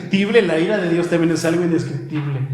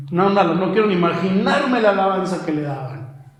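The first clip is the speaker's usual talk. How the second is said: ordinary talk, nothing marked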